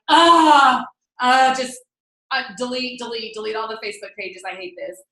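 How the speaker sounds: groan, then give up in mock disgust; hammed-up meltdown that deflates. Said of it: "talking about highs and lows".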